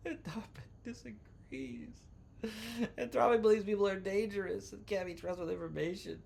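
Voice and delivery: whimpering parody of a crybaby; mock crying. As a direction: read it like you're about to burst into tears, as a joke. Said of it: "I should read this like I'm crying".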